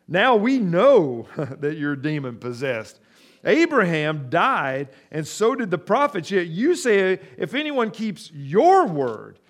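loud, slow, scornful challenge; loud mocking crowd voice; indignant accusers. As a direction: hurl the accusation at him.

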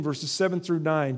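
usual speaking voice

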